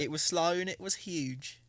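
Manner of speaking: high, slow put-on British accent; slow, hammy British accent; mock British accent. Trying a British accent again for friends, mock accent. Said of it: "that also sounds".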